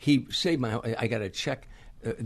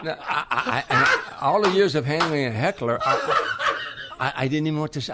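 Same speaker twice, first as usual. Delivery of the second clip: laughing; 6 semitones higher, 7 dB louder than usual. Real, not acted. Laughing while retelling awkward moment.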